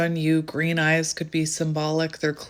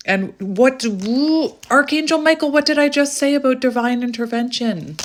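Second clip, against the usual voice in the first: much higher, louder excitement; excited; 6 semitones higher, 5 dB louder than usual. Real, not acted. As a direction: cry out in amazed triumph. Archangel card appears.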